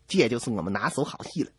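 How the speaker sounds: usual speaking voice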